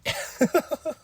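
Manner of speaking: squeaky laugh, high up; high squeal laugh. Real, not acted. Laughs at own bit.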